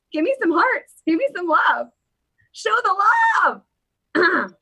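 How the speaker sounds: high, showy call for affection; playful pleading. Pretend. Host begging viewers for hearts, playfully hammed-up demand. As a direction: build to a drawn-out holler on love.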